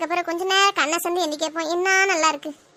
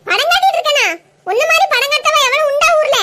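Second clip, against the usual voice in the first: high, loud, offended comeback; mother-in-law snaps back at the daughter-in-law's jibe; indignant retort; 5 semitones higher, 4 dB louder than usual. Acted. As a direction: throw the accusation right back.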